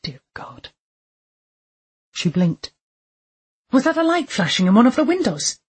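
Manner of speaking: usual speaking voice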